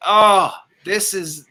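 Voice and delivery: ordinary delivery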